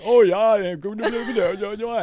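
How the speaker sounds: high, loud Yooper chatter; loud mock Yooper babble; mock Yooper friends. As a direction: gush it like excited local friends. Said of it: "they're like".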